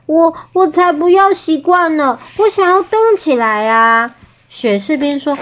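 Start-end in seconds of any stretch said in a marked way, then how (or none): 0.1-4.2 high, protesting storybook shout; mild childlike yell; crying out. A narrator's reading. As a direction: call out in protest, pitched up but not full volume. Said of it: "小史丹大喊".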